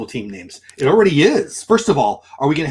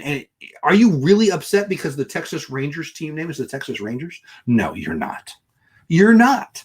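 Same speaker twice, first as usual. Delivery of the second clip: usual speaking voice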